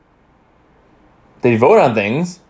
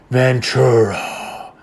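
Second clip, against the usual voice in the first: slow, drawn-out sinister call of the name; creepy landlord; much slower than usual. Retold or imitated. Landlord calling Ace Ventura, slow sinister drawl. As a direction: drag the name out like a menacing landlord. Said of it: "the landlord is talking to him".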